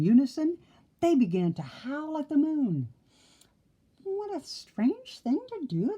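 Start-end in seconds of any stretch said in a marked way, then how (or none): 4.0-6.0 high, puzzled piggy exclaim. A narrator's reading. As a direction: squeak it out, baffled. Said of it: "the brothers exclaimed".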